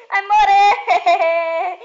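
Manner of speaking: very slow, loud laughing threat; panicking gamer. Real, not acted. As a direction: drag it out through the laughter.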